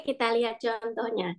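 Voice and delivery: ordinary talk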